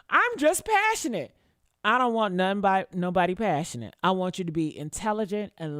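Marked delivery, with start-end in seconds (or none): 0.1-1.3 mocking excuse. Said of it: "That's what they try to excuse".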